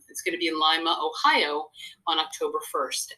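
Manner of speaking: ordinary talk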